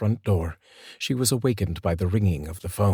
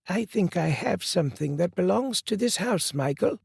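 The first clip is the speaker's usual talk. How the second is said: lightly voiced woman; 11 semitones higher than usual. A narrator's reading. Woman at the door returning the boy, lightly voiced. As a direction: say it higher and gently.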